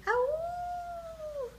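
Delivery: long, high, soft-edged howl; wolf howl. A narrator's reading. Little Bear charges across the meadow, high howl.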